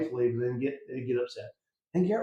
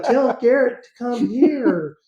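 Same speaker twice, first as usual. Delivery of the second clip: put-on higher, louder, slow kid voice, drawn out; sneaky kid voice; 9 semitones higher, 11 dB louder, slower than usual. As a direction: draw it out like a scheming little kid peeking through the door. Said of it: "little beady face going".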